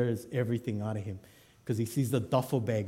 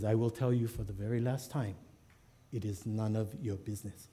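low, very soft final warning; icy, hushed and measured; stone cold; 3 semitones lower, 7 dB quieter than usual. Acted. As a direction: deliver a cold last refusal, barely raised. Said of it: "goes stone cold".

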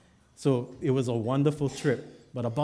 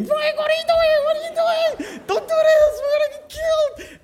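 very high, loud hysterical shriek; shrieking falsetto panic; panicked screaming; 9 dB louder than usual. Acted. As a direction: scream in hammed-up terror at the driver. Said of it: "He's screaming".

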